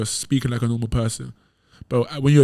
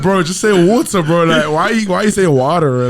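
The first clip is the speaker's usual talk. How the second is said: higher, louder, laughing through it; laughing retort; 5 semitones higher, 6 dB louder than usual. Retold or imitated. To Americans saying water, laughing higher and louder. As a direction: laugh while protesting the accent. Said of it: "I'm like".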